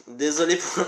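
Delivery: ordinary talk, nothing marked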